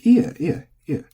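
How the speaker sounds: ie ie ie high and loud, quick falls; repeated ie, each pitched high then dropping; accented ie. Shown as a demonstration. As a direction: brush off thanks with a bright ie. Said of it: "you say".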